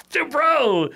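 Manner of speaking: high, loud retold plea; dismayed protest. Customer pleading with driver over package, dismayed protest.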